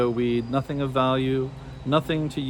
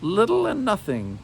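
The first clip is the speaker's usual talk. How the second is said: hag voice; 8 semitones higher than usual. A narrator's reading.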